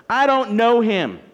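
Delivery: acted, drawn-out disowning; emphatic denial. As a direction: bear down on each word of the denial. Replaying Peter's last words.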